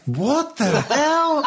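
high, slow shocked outburst, laughing. Real, not acted. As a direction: burst out laughing in disbelief. Shocked by rude joke.

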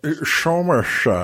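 slurred Scottish "Somerset" as Shomershet; hammed-up Connery Scottish accent; lightly done bad Sean Connery accent. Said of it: "Sean Connery accent".

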